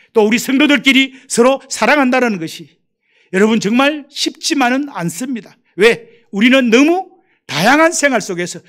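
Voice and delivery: impassioned pulpit appeal; preaching. Acted. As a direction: preach it out to the pews. Preaching to congregation.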